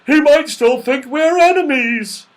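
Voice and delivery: loud, high-strained bear voice; Father Bear, worried. A narrator's reading. Father Bear fears being taken for enemies, urgent. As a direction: warn them as an anxious bear. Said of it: "said the Father Bear".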